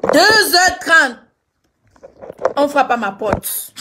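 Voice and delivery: loud, dragged-out dramatic repeat; slow loud suspense. Real, not acted.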